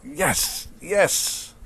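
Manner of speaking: rising, high triumphant yes; very happy. Acted. Teacher voicing a very happy figure, high triumphant yes. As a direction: celebrate with arms out. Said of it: "very happy".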